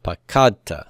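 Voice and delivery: pakadta with the weight on KAD; pa-KAD-ta, pakadta falling away after KAD; stress on middle syllable. Shown as a demonstration. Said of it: "root two".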